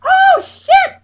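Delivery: very high, loud panicked scream. Retold or imitated. Friend seeing the car go the wrong way, screaming. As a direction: shriek it in terror.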